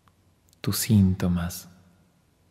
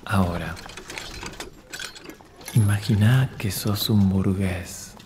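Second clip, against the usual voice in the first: guided visualization; 9 dB quieter than usual. Acted. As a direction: lead the listener gently into the imagined scene. Leads guided imagination exercise.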